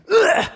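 sudden high, loud "ugh"; punching grunt. Retold or imitated. Kid punching Spider-Man, a loud mock grunt. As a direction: grunt out the blow.

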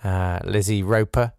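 ordinary talk

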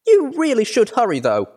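put-on very high, loud little-girl voice; high-pitched young filly; excitable filly. A narrator's reading. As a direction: urge them on in a high child's voice. Excited filly urges visitors on.

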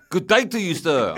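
loud South African sign-off; South African accent. Acted. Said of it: "I don't know if that's how it".

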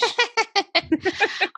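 a high burst of real laughter. Real, not acted. Friend laughs high at the fish name joke.

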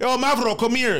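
put-on high-pitched call across the room in a Greek accent; imitating a Greek friend calling out. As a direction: shout him over, Greek style.

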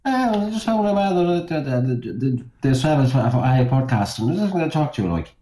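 high-pitched put-on Irish accent. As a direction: chat on in a jokey Irish accent. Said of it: "can you do your Irish accent".

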